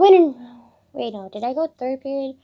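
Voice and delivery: ordinary talk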